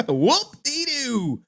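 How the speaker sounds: high, very slow whoop-dee-doo; mock celebration; sarcastic cheer. Echoing the soundbite.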